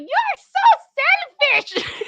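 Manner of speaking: high, loud, very drawn-out screech with laughter; mock yelling. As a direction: screech it slowly, breaking into laughter. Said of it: "the French peas just yelling".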